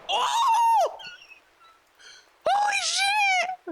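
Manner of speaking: high, loud startled yell; shocked shout. Real, not acted. Player jolted by a gunshot in the game, yelling. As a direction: blurt it out in pure shock.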